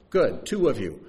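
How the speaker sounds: usual speaking voice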